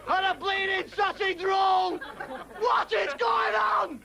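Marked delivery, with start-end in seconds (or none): 2.6-4.0 slow, drawn-out bellow; slow exasperated rant; slow outraged shout. Acted. Ranting comic character.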